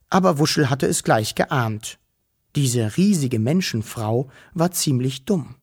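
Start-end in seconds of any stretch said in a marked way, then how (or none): none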